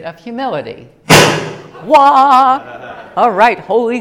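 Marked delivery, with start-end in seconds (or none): 1.1-1.8 loud startled laugh; burst of laughter. Real, not acted. Speaker laughing at her own slip. 1.8-3.1 loud, high startled cry; startled yelp. Real, not acted. Speaker crying out in surprise.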